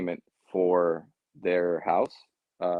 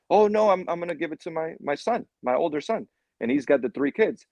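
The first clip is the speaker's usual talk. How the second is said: higher-pitched older homeowner, lightly voiced; playing the older customer; 6 semitones higher than usual. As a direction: answer as a pleased grandpa, pitched up. Salesman voices elderly homeowner reply.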